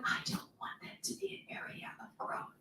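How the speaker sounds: fast conspiratorial whisper; whispered aside. Real, not acted. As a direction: lean in and whisper the secret. Confiding aside to congregation.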